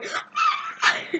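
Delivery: very high, small squealed scream; mock fan scream. [name] mimics the screaming fans, high soft mock scream. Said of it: "we were just screaming at her".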